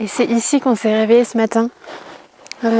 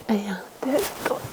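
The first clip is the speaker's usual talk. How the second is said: whispered countdown; whispering; 11 dB quieter than usual. Real, not acted. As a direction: count down under your breath. Compte avant de sauter. (compter en chuchotant)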